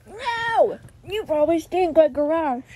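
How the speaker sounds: high, loud teasing shout; playful shout. Real, not acted. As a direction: yell it playfully at him. Teasing brother, sending him away.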